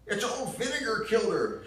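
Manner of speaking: put-on high, loud bitter accusation in a southern accent; bitter southern mother. Ma blaming Gramps for Gran's death, loud southern bitterness. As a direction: spit the blame out in a shrill Southern voice. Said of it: "Ma starting in on that nasty tune of hers".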